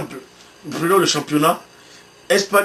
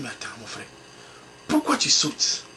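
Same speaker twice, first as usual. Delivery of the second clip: a soft whisper to himself; whispered self-talk; 7 semitones lower, 8 dB quieter than usual. Retold or imitated. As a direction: whisper it, catching your own mistake. Se parle à lui-même. (chuchoté pour soi-même)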